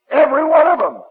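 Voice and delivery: loud, high angry shout. Retold or imitated. Bill shouting at the student about the Bible, angry. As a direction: hammer it home in anger. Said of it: "And he was angry".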